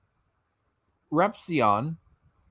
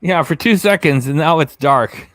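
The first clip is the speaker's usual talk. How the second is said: loud reaction; 2 semitones higher, 9 dB louder than usual. Real, not acted. Reacting loudly to screen.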